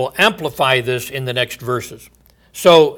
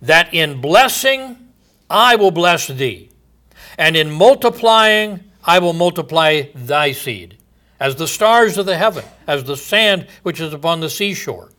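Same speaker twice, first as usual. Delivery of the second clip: raised, ringing pulpit voice; solemn scripture reading; 3 semitones higher, 4 dB louder than usual. Acted. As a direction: declaim God's oath from the pulpit. Reading the KJV promise aloud.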